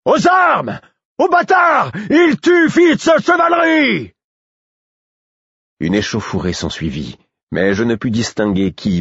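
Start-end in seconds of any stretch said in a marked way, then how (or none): 0.1-4.1 very high, loud alarm call, slowing at the end; crying out. A narrator's reading. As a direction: raise the alarm over the crowd. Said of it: "quelqu'un cria".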